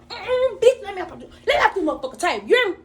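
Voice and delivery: high, rushed mock fighting protest; acted struggle. Drugged dreamer demanding to be let up, acted struggle.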